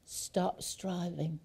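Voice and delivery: a calm slow hush; soft and slow. Shown as a demonstration. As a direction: ease the words down gently. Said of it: "we're gonna have to".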